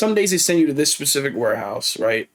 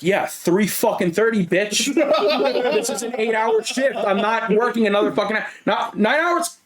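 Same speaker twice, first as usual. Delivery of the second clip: mock defiance; 6 semitones higher than usual. Retells telling off his coworker.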